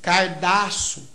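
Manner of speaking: "cardaço" with r for l, slowly; swapped sounds. Shown as a demonstration. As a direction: drawl "cardaço", an r where the l belongs.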